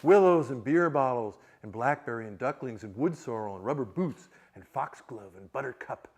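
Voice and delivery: slow, measured literary reading; reading aloud. Acted. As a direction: let the river's list flow slowly. Reads novel passage, river speaking.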